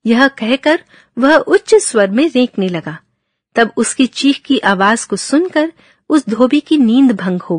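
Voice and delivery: loud, steady storytelling voice; story narration. A narrator's reading. Teacher reads a Sanskrit fable to students, loud narration. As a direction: read the fable out to the class.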